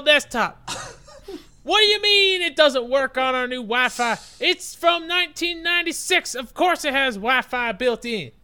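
high, loud mock Southern caller; hammed-up Southern twang, pitched high; Southern accent. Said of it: "unless Southern people really love podcasts".

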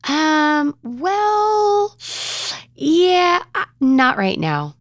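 high, drawn-out imitation of a stalling child; reluctant stalling. Seven-year-old put on the spot by mom, reluctant. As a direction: stretch each filler high, then back out slowly.

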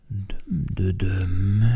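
slow, drawn-out creature voice; Dömdödöm voice. Acted. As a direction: say his one word slowly, oddly. Dömdödöm's only word. (lassú, furcsa lényhang)

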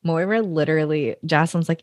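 ordinary talk

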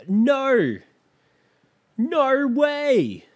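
high, loud mock British gasp; British shriek. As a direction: squeal it like a reality-show British girl. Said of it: "She's talking in a super British accent".

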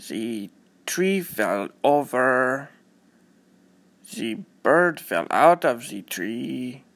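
put-on slow, halting German-accented reading. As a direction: read slowly with a thick German accent. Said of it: "because he had a German accent".

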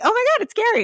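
high-pitched excited gush; starstruck excitement. Retold or imitated. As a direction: gush as if spotting a star. Retelling spotting a celebrity outside.

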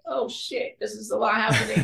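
high, laughing "oh shit"; overwhelmed laugh. Retold or imitated. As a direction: laugh it out, swamped by instructions. Overwhelmed by stunt instructions.

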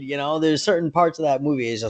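ordinary delivery

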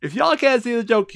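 ordinary delivery

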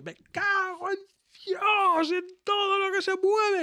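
high, hammed-up curse at the game; mock rage. Father mocking his own rage at the crash, high hammed-up cursing. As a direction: rant like a gamer losing it, hammed-up. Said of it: "y yo".

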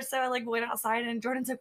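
usual speaking voice

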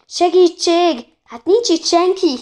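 high, loud, stretched call; cry for help. A narrator's reading. Woman at the deserted reception desk, high cry for help. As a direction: shout desperately for anyone to come.

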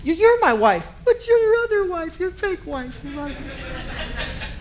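high, wavering voice that fades to a sob; mimicking a tearful, sobbing wife; mock sobbing wife. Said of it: "She says".

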